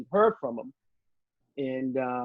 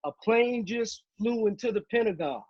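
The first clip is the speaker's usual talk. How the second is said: retold yell; 8 semitones higher than usual. Someone announcing the Pentagon crash, mild retold yell. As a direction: relay someone's shouted alarm without raising the volume. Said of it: "someone yelled out".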